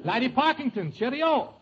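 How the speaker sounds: put-on English accent. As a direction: play an eager English baronet. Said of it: "you speak with an English accent".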